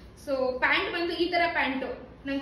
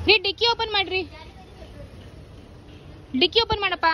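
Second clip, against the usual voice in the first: calling out; 6 semitones higher than usual. Real, not acted. Calling husband to open trunk.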